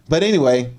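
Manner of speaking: ordinary talk, nothing marked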